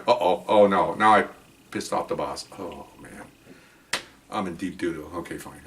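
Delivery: low, fading mock dismay; hushed, resigned oh-man aside, acted; sinking dread. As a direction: trail down softly as trouble sinks in. Acts out his dread at trouble.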